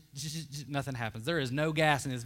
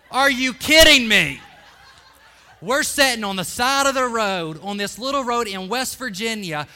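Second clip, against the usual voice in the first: much louder, higher laughing indignation; loud outrage; 4 semitones higher, 10 dB louder than usual. Real, not acted. Preacher scolding the mom who waits for American Idol, loud. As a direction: rail at it in disbelief.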